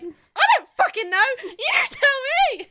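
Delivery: loud, high, exasperated snap; exasperated shout. Retold or imitated. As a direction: throw it back, fed up and shouting. Exasperated retort to ambulance operator.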